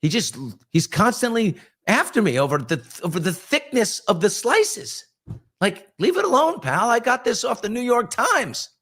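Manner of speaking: high, whiny protest about turkey slices; hammed-up high-pitched grievance; mock indignant rant. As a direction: play your own younger self huffing and defending his slicing. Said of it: "And I'm like".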